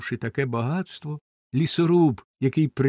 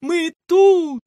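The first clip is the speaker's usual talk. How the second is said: high, loud happy call; children call out gladly from outside; joyful shout; 10 dB louder than usual. Acted. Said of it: "І ну радісно гукати всі разом". (радісний дитячий вигук)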